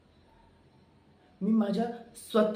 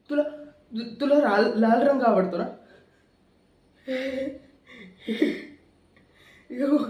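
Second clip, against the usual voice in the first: menacing loud taunt; 4 semitones higher, 6 dB louder than usual. Acted. Villain menacing crying woman.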